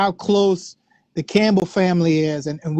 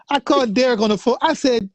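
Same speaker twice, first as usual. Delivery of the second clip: ordinary talk, nothing marked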